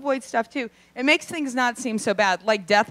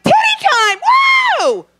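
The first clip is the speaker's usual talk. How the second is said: shrieked, much higher and louder; party shriek; 8 dB louder than usual. Retold or imitated. The party girl yelling to everyone, high and loud. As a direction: whoop it like a drunk party girl. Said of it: "the girl at the party that's like".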